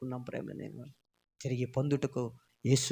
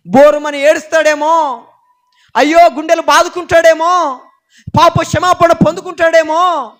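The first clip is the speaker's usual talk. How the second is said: louder, higher pulpit preaching; fiery preaching; 13 semitones higher, 18 dB louder than usual. Acted. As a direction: drive it home to the congregation. Preaching at full force.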